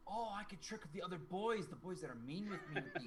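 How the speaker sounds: put-on gleeful child hatching a trick; scheming little girl. Little girl planning to trick mean boys, excited. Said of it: "Oh, she's like".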